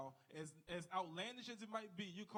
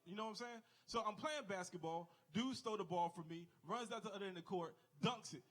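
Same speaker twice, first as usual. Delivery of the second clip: ordinary delivery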